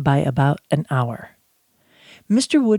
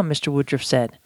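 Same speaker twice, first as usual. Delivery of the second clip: ordinary delivery, nothing marked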